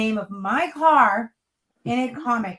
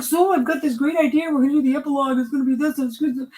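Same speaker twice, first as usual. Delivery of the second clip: eager pitch; 5 semitones higher, 4 dB louder, faster than usual. Retold or imitated. Wife retells husband's pitch.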